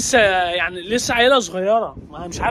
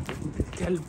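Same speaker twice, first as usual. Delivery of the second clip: low, much softer exasperated curse; muttered curse; 4 semitones lower, 10 dB quieter than usual. Real, not acted. Cursing the runaway dog under his breath, quiet mutter. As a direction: grumble it under your breath.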